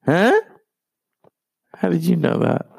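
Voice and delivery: startled disbelief. Retold or imitated. Retelling his reply to his straight friend, mock startled. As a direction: caught off guard by what he just revealed.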